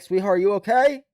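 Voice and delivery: high, loud mock-friendly call; mock sweet call. Viewer imagines calling to the girl by the road. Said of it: "I might be like".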